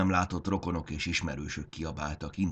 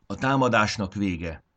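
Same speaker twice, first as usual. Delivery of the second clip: firm command; 4 semitones higher, 6 dB louder than usual. Acted. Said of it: "adta ki a parancsot".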